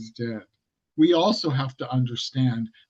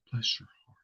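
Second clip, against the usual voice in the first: whisper; 3 semitones lower, 8 dB quieter, faster than usual. Retold or imitated. Repeats the Southern put-down.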